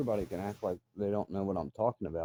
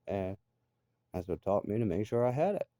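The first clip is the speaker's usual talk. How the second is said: normal talking voice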